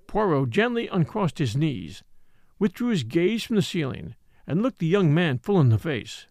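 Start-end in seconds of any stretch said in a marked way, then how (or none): none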